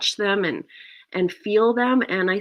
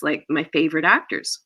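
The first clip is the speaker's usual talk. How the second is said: ordinary delivery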